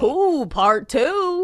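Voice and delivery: excited ooh. Real, not acted. Cohost teasing a story sequel.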